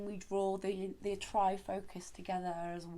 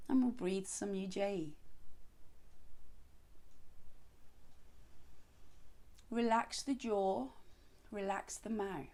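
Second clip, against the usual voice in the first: guided meditation; 5 dB quieter than usual. Acted. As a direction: ease them into stillness gently. Leads seated breathing practice.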